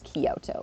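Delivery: "Kyoto" in three syllables, ki-o-to; visitor's mispronunciation. Retold or imitated. Visitor correcting the resident, "Kyoto" split as ki-o-to. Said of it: "And they're like, Actually, it's Kyoto".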